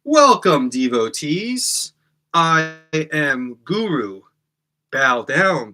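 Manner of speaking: slow, grand, loud spiritual welcome; Russell Brand guru. Shown as a demonstration. Host as Guru welcoming his devotees in a grand slow voice. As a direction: drawl it like a self-styled guru. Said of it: "like Russell Brand might do".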